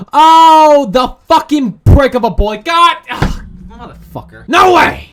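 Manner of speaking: high, loud groan of disgust; loud exasperation. Real, not acted. Gamer rages at the fat boy on screen, loud disgust. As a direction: vent at the screen.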